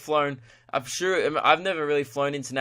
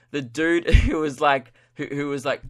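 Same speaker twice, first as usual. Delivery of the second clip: ordinary delivery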